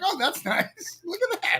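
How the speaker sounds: high mock delight. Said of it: "I was like".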